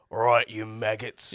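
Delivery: big brute drill sergeant voice; dopey cartoon bellow. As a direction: bark it like a dim brute. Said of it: "What does Grint sound like?".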